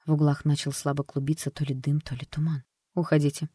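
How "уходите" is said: ordinary delivery, nothing marked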